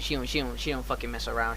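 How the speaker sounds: normal talking voice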